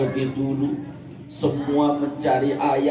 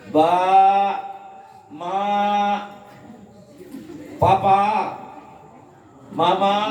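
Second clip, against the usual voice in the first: calling out; 8 semitones higher than usual. Acted. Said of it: "Dia sambil teriak-teriak".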